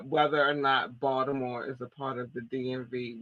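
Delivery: ordinary delivery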